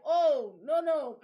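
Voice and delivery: mock indignant denial; loud protest. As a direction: push back hard on the assumption. Retold reply to locals.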